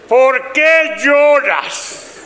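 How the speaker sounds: high, hugely drawn-out exasperated question; sin cariño alguno. Acted. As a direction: stretch every word as a fed-up, unloving husband. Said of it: "lo de cariño se jodió". (marido harto, voz alta y alargada)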